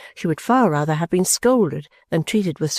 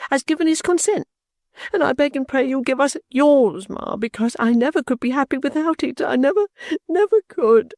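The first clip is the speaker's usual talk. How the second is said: much higher, tearful begging; sobbing; 7 semitones higher than usual. A narrator's reading. Caddy begs her mother for consent, sobbing. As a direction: plead through tears for consent. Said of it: "sobbed Caddy".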